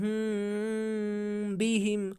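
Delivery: slow, हुम hummed through the nose into बिहिम; nasal ikhfa. Shown as a demonstration. Teacher showing students the right reading, slow nasal hum. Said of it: "बल्कि हम पढ़ेंगे बिहिम".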